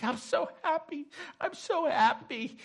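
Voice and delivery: high-pitched, tearful-sounding happy gush; acting out her overwhelmed happiness; overjoyed. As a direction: squeeze out the joy in a high voice. Voices overjoyed widow.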